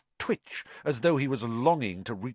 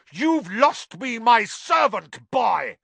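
furious; 9 semitones higher, 7 dB louder, slower than usual. Acted. Malfoy lunges at Harry.

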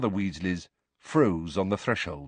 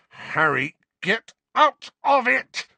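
loud, high, drawn-out snarl; Sirius, snarling; 14 semitones higher, 4 dB louder, slower than usual. A narrator's reading. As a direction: snarl the order slowly and forcefully. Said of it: "snarled Sirius".

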